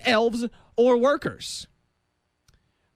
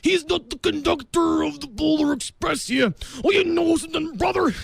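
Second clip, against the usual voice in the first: wrestler impression; 5 semitones higher than usual. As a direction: bellow it like a wrestler cutting a promo. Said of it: "Let's get out of my impressions".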